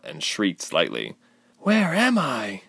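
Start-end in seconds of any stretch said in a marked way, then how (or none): 1.6-2.6 high, drawn-out cry. A narrator's reading. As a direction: cry out, lost and bewildered. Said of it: "Ilse cried".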